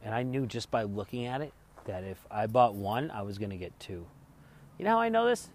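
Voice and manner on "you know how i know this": pitched up high, eager to tell; excited rhetorical question. Real, not acted. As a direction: tease the story with a lift.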